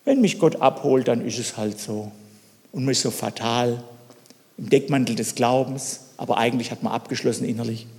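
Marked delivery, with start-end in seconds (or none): 0.0-2.1 resigned shrug. Pretend. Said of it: "der sagt".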